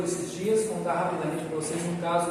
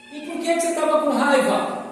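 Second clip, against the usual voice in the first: higher, louder teacher asking why; a teacher questioning a boy, higher and louder; probing teacher; 7 semitones higher, 5 dB louder, faster than usual. Retold or imitated. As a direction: press the child for the reason, lightly voiced. Teacher presses again.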